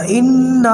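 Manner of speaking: ان with the nasal ن held long through the nose; nasal ghunna. Shown as a demonstration. Teacher demonstrating ghunna to students: ان held long and nasal. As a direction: stretch the ن in ان into a humming ghunna. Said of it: "تھوڑا لمبا کر کے پڑھیں گے غنہ کے اندر".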